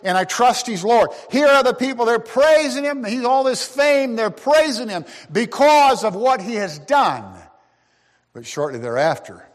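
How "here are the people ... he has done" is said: high, ringing preacher's call; preaching at full force. Acted. Preacher stirring the congregation with rising fervor. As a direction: lift the crowd with it.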